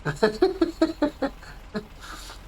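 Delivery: long high-pitched giggle. Real, not acted. Laughing at himself on the floor like a child, high giggle.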